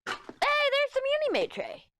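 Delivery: very high, slow sing-song; sing-song announcement of a tray. Acted. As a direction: stretch it out playfully up high. Playful sing-song aside.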